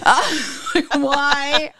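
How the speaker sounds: high, laughing through words. Real, not acted. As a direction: laugh at yourself. Laughing at her own mistake.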